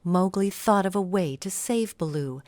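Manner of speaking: plain reading voice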